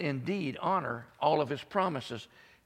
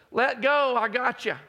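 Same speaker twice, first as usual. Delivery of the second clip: voice from above; 11 semitones higher, 8 dB louder than usual. Retold or imitated. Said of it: "and the voice says".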